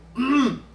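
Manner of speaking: loud sharp throat clear. Shown as a demonstration. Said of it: "Throat clearing".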